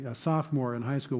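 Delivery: normal talking voice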